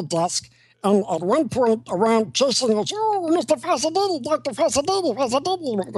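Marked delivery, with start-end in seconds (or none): 2.9-6.0 high, flirty nurse voice calling after the doctor; breathless nurse. Retold or imitated. Actor playing the doctor voices the nurse, high and flirty. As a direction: coo his name as the nurse being chased.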